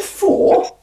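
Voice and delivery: pitched high, slow disbelief; incredulous. Retold or imitated. Footballer retelling his shock at the tiny number. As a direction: stretch out the shock of four in ninety. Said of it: "I said".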